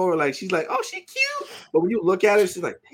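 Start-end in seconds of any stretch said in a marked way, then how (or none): 0.7-1.5 high squeaky soft aside; retold cooing. Reacting to a cute character, high soft coo.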